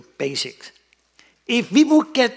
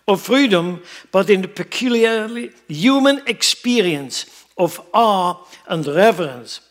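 loud, raised, emphatic sermon voice; forceful preaching; 6 semitones higher, 7 dB louder than usual. Real, not acted. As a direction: drive it home to the whole congregation. Preaches on a quoted passage.

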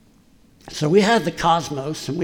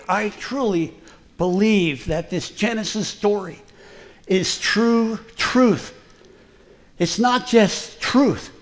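ordinary delivery

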